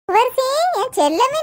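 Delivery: slow, tender call to a daughter; affectionate mother's welcome; tender greeting. Acted. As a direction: greet her like a long-missed child.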